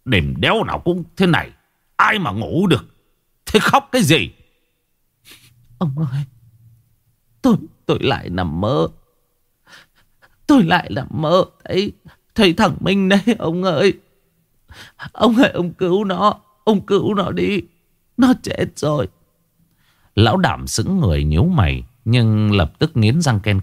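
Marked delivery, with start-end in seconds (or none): 0.1-4.3 grumpy husband, pitch climbing into exasperation; irritated. A narrator's reading. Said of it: "Lão Đảm thì bực bội". 5.8-19.1 high-pitched, sobbing wife, broken by gasps; tearful wailing. A narrator's reading. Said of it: "rồi lại ôm mặt khóc tu tu".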